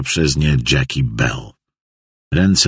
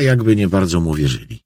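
ordinary delivery

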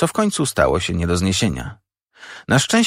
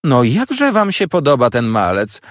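high, raised, mildly indignant voice; indignant cry; 6 semitones higher, 4 dB louder than usual. A narrator's reading. Sister complains about the boy to those present, indignant cry. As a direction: exclaim in displeasure at the child. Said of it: "krzyknęła siostra".

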